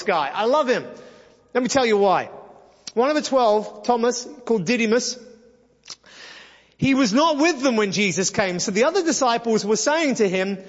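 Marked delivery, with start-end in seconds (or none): none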